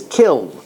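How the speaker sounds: "kiln" with its final N pronounced. Shown as a demonstration. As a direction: sound the N after the L in "kiln". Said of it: "opinions from, uh, different dictionaries".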